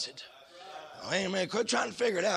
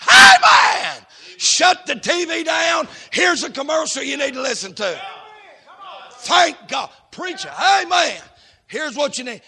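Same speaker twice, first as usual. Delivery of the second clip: loud, higher shouted preaching; preaching at full force; 9 semitones higher, 13 dB louder than usual. Acted. Preacher rousing the congregation with a shout. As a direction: rouse the room.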